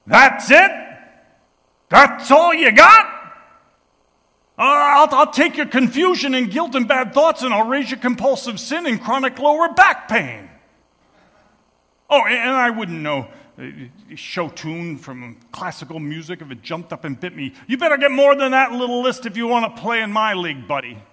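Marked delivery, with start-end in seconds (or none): 0.0-10.5 loud, much higher challenging shout; yelling from the back. Retold or imitated. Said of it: "a voice that yells out". 17.7-21.0 loud, higher, cocky taunt; same voice daring him to raise; brash challenge. Retold or imitated. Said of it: "yells out".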